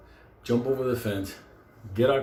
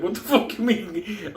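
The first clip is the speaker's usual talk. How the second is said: higher, drawn-out mock disbelief; incredulous; 7 semitones higher, slower than usual. Friend told to jump the fence, acted incredulity. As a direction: question it in raised, stretched disbelief.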